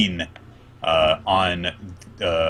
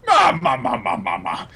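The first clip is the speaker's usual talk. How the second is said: ma ma ma laugh fading down; Big Mom's laugh. Retold or imitated. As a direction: cackle ma ma ma like a giant queen. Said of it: "her unique laugh".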